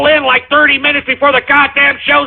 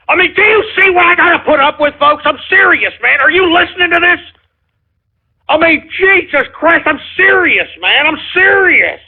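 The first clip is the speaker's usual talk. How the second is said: exasperated; 6 semitones higher than usual. Real, not acted. Exasperated host to listeners.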